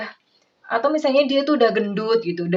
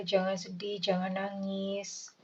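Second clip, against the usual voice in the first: soft comfort; 11 dB quieter, slower than usual. Pretend. Soothing the partner.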